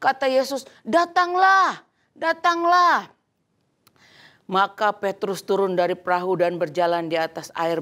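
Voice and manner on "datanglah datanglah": slow, high, louder call to come; calling across water. Acted. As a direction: beckon him slowly from afar. Jesus calls Peter over water. (panggilan keras dan lambat)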